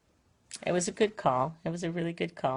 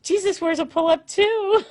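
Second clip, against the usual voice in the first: put-on much higher, louder piping toddler voice; excited little girl; 7 dB louder than usual. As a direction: squeal it like a delighted three-year-old. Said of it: "she was all excited about it".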